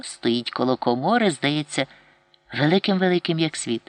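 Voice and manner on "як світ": ordinary delivery, nothing marked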